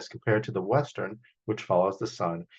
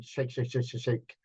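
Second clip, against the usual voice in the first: softer, rhythmic shake chant; 6 dB quieter than usual. Acted. Storyteller chants the shaking of the tree, softer.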